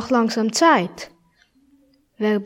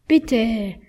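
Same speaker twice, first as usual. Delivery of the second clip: ordinary delivery, nothing marked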